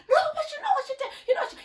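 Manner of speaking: high, very fast imitated rant; mock angry girl. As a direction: rattle it off shrilly like the girl. Girl argues at the door.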